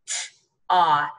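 "t, os": t alone, pause, then rime; sounded out. Shown as a demonstration. Teacher reviews toss with one s, sounded out. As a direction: split "t, os" before blending. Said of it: "only going to make one of those letter".

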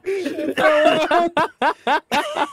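laughing while talking; words through laughter. Real, not acted. Laughing at a mishap.

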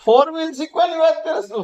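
can't believe she wants a car; incredulous. Real, not acted. Husband to wife about driving lessons, incredulous high-pitched question.

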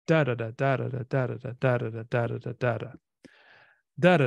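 "Da, da, da" in threes, first stressed, closing on a pair; dactylic rhythm. Shown as a demonstration. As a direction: chant hexameter on "da", strong-weak-weak. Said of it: "it's probably this one".

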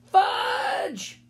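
high, drawn-out shout of a name; exasperated mother's shout. A narrator's reading. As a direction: yell for the child, very high. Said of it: "Then she shouted".

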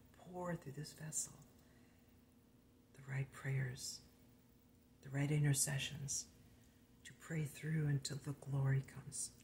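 hushed earnest prayer; whispered prayer. Acted. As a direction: pray it softly under the breath. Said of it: "We just say".

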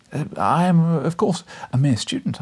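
facetious. A narrator's reading. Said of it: "with a facetious smile".

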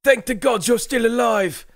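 high, loud voice of a nervous northern soldier; rough soldier Dirk. Acted. Dirk reports to his commander Morgrig, high anxious soldier voice. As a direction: answer your commander, anxious and eager.

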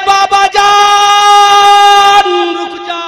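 very slow, drawn-out high wail; anguished cry. Acted. Daughter cries out to stop her father, loud wail. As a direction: stretch out بابا جان as a long high cry. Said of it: "اندر سے آواز آئی".

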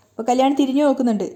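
ordinary delivery

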